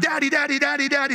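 put-on high, repeated, insistent child's call; pestering little kid. Kids interrupting dad among other adults, pestering. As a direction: nag for dad's attention without stopping. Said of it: "they don't care who's around".

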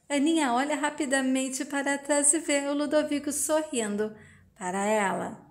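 usual speaking voice

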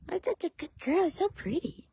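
high soft praise. Real, not acted. Owner praising her horse, soft high baby talk. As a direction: coo the praise softly.

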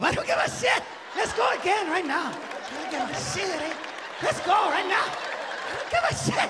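hammed-up brawler begging for round two; little Mexican brawler taunting. Beaten tiny fighter taunting his opponent again. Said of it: "Fucking bit off his earlobe".

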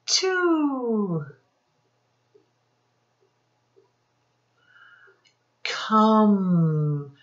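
put-on each word said slow and clear for kids; teacher drilling single words; sight word drill. Shown as a demonstration. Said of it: "let's go over some sight words".

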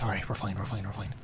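calm yourself down under your breath; quiet self-reassurance. Real, not acted. Gamer soothing himself after a scare. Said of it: "reassuring himself".

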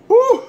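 high loud whoop from the heat; pained whoop. Real, not acted. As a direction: yelp it out as the sauce burns. Burning from hot sauce.

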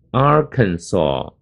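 "Arkansas" slowly as AR-kan-saw; correct pronunciation demo. Shown as a demonstration. Teacher introducing a tricky state name, "Arkansas" slowly ending in saw. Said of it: "特别要这个把它念好".

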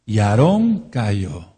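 Aarón cayó drawn out very slowly; slow solemn stress. Shown as a demonstration. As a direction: let "Y Aarón cayó" fall slow and heavy. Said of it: "Eso es muy importante".

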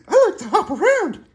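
hyper cricket. Pretend. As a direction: bounce it out like an excited little bug. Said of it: "He likes to get all hyper".

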